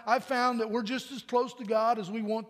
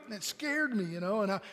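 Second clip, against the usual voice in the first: usual speaking voice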